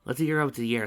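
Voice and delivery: ordinary delivery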